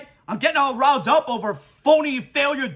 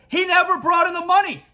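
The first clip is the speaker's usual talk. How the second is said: ordinary delivery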